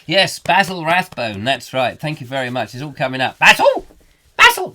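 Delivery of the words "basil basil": very high, loud screech of the name; calling out. Acted. Wife summoning husband, high piercing call. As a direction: screech for your husband from across the house.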